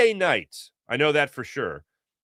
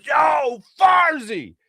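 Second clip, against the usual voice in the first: high, loud shout from a passing car; a fan yelling from a car window; calling out; 8 dB louder, much slower than usual. Retold or imitated. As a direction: holler his name across the street.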